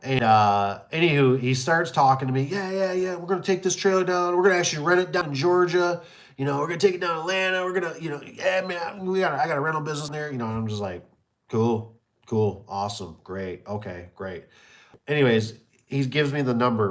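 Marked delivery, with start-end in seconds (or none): 2.4-10.3 mock high-pitched customer patter; imitated fast talker. Customer pitching his trailer plans to seller, imitated eager patter. 11.5-14.4 low, soft, tailing-away acknowledgements; retold unenthused agreement. Seller answering a customer's big plans, retold unenthused agreement.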